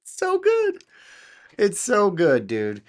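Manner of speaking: very high, loud squeal; amazed delight. Real, not acted. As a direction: squeal it out, thrilled by the result. Delighted by cloned publisher voice.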